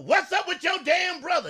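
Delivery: loud sarcastic snap at the brother question; mock angry shout. Girlfriend mockingly shouts to show her boyfriend the tone. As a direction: bark it out to show how it sounds. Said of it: "How you want me to say it?".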